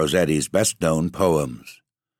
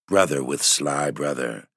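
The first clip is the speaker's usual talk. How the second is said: ordinary delivery, nothing marked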